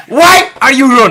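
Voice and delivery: very high, very loud mock shout; silly put-on voice. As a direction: shriek it in a goofy put-on voice. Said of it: "that's not the fucking voice you're doing".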